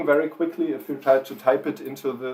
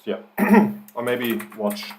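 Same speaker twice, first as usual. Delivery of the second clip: ordinary talk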